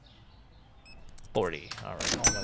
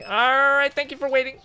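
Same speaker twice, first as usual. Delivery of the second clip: much higher, bright customer-service greeting; chirpy cashier voice; 10 semitones higher, 5 dB louder than usual. Acted. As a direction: sing it up high like an overly cheerful clerk. Cashier greets customer in sing-song voice.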